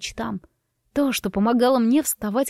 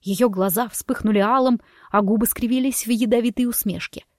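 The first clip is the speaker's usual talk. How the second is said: usual speaking voice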